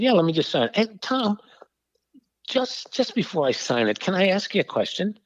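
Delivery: a hint of a teasing old Jesuit; gentle priest voice.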